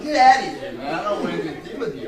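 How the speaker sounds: loud, high, fast brush-off; mock angry shout. Nervous son sending his dad away, mock angry shout. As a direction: send dad packing in a hammed-up shout.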